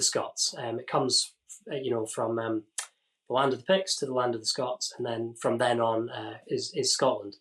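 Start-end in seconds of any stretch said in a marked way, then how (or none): none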